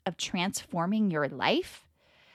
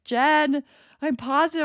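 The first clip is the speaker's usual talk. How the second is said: louder, higher announcement of bad news; lightly voiced husband; 6 semitones higher, 6 dB louder than usual. Husband telling his wife of his covid test, lightly imitated. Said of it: "he's like".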